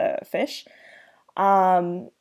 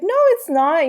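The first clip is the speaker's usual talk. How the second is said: put-on much higher, louder childish denial; Rudy's denial; 10 semitones higher, 5 dB louder than usual. Rudy refusing to accept the fish is dead, high loud. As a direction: insist like a kid who won't accept it. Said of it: "Rudy's like".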